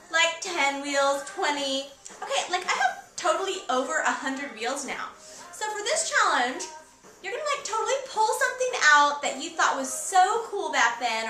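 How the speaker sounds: playing an eighties valley girl. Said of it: "stop talking like a valley girl now".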